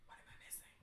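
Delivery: barely audible whispered question. Real, not acted. Reader searching the panels for danger, whispering. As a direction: whisper it to yourself. Muttering to self while reading.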